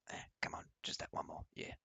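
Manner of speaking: soft, whispered coaxing; muttering to self. Real, not acted. Streamer nudging the volume down, whispered mutter. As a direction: coax it under your breath.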